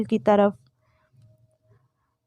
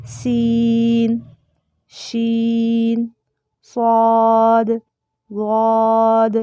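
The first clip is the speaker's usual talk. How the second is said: louder, deliberate letter-by-letter recital; alphabet recital; 5 dB louder, much slower than usual. Shown as a demonstration. Teacher reciting letter names for students to follow. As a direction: call out each letter name clearly with pauses. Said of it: "نیچے آپ دیکھیں باریک سا نام لکھا ہوا ہے".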